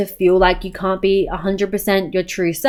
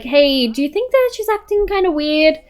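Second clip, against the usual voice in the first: character voice; 7 semitones higher than usual. Acted. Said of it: "She's gonna be like".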